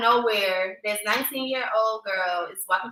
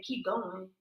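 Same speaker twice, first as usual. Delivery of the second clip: inner voice urging; 2 semitones lower, 11 dB quieter than usual. Retold or imitated. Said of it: "this voice in my head saying".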